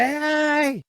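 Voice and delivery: high, loud mock call of the woman; a sharp high shout to flag him down; shrill-free high hailing call. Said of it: "She's like".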